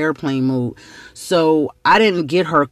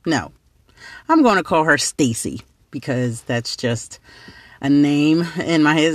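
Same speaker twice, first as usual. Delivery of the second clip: plain reading voice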